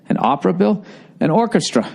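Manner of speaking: usual speaking voice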